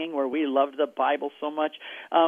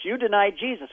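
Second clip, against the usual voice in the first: higher, louder accusation; accusing; 6 semitones higher, 4 dB louder than usual. Retold or imitated. Apostles accusing Peter, higher and louder. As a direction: throw it in his face.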